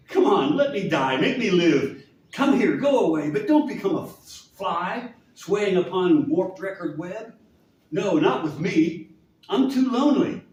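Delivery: loud, high dramatic pleading; impassioned plea. Acted. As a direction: cry it out in recital. Anguished plea within the poem.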